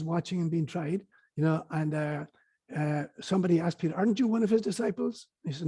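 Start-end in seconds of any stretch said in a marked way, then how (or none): 3.9-5.3 lightly acted higher-pitched challenge; retold accusing question; questioning accuser. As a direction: put the question as a suspicious bystander. Voicing Peter's accuser.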